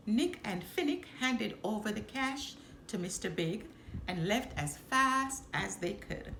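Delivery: ordinary delivery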